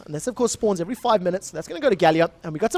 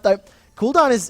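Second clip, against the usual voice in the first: ordinary talk, nothing marked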